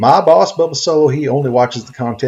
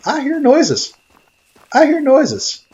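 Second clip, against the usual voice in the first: excited; 12 semitones higher, slower than usual. Acted. Startled by noises.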